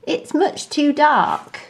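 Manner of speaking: high, whiny grumble; grumpy little monkey voice; complaining monkey. A narrator's reading. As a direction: moan about the dark.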